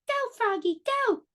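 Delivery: very high cheering call; cheering shout. A narrator's reading. Friend cheers Froggy riding his bike, very high cheer. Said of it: "Froggy's friend shouted".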